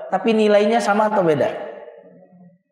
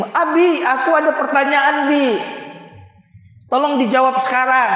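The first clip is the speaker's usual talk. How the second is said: put-on high, eager child asking Abi; daughter's voice; 8 semitones higher than usual. Daughter interrupting her father's class, high and eager. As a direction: pitch up like an insistent little girl.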